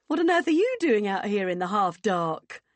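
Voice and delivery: loud, hearty questioning; booming hearty woman. Acted. Vicky finds the pair outside, booming. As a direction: boom the question out across the garden.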